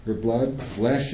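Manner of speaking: ordinary delivery, nothing marked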